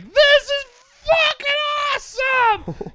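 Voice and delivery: very high, loud thrilled whoops; joyful yelling. Retold or imitated. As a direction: scream with delight while being towed. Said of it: "And we're yelling".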